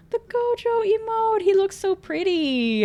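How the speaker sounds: high-pitched fangirl coo; adoring coo. Real, not acted. Cooing over a pretty emote.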